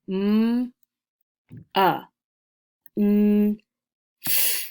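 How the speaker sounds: MONTH split into sounds "M, a, n, th", long pauses between; sounded out. Shown as a demonstration. Teacher counts the sounds in month, sounded out separately. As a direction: hold each sound of "M, a, n, th" on its own. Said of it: "count the sounds in the word month".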